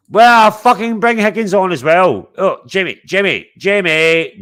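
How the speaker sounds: high, loud calls for Jamie; loud excited calling out. Real, not acted. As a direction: shout across for your mate to join.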